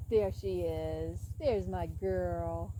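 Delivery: slow, soft, fond pet greeting; tender. Real, not acted. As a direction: greet the horse slowly and gently. Greeting her horse.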